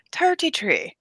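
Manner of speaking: put-on Irish-accented "Thirty-three", pitched high and falling; lilting Irish imitation with a falling end; imitated Irish Siri. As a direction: mimic an Irish assistant saying "Thirty-three". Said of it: "I wanted to hear him say".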